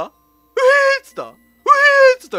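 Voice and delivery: high scared whine; frightened whimper. Real, not acted. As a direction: whine it in fear. Hears creepy sound.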